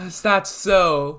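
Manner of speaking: villain Shigaraki. Retold or imitated. Shigaraki mocking Izuku, slow smug villain voice. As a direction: savor the words like a smug villain. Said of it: "And Shigaraki's like".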